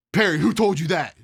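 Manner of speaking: sharp demand. Retold or imitated. Drill instructor interrogating.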